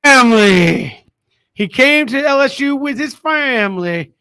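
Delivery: loud, hammed-up fake Southern drawl; mock the coach's put-on Southern accent, loudly; exaggerated fake Southern twang on "family". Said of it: "has developed a new accent".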